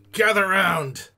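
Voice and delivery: ship's officer bellowing an order; shouted summons. Acted. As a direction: holler for everyone to assemble. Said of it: "He looks at the rest of you. He's like".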